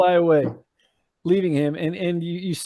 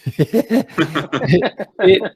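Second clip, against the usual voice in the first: ordinary talk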